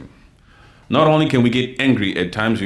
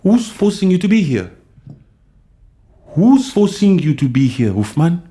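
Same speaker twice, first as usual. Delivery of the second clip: heated, higher-pitched demand; angry challenge; 5 semitones higher than usual. Real, not acted. Streamer challenging a viewer who objects to his tone, angrily. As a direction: throw the question out as a sharp challenge. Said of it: "If you think this tone is too much".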